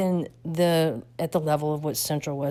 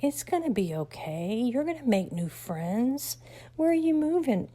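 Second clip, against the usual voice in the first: higher, soothing teacher voice; reassuring teacher; 7 semitones higher than usual. Retold or imitated. Teacher soothing the upset student, higher and soothing.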